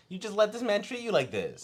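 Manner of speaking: high-pitched indignant reproach; incredulous scolding. Retold or imitated. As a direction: scold her in sheer disbelief. Retold outraged scolding continues.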